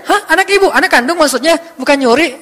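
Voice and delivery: hammed-up double take; incredulous questioning. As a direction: fire off amazed questions. Acts out disbelief at the baby.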